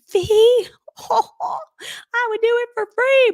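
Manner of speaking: high, loud, slow amazed squeal breaking into a laugh; surprised. Retold or imitated. Telling the CEO she'd work free, high, slow and laughing. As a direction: caught off guard by the word fee. CEO asks her fee.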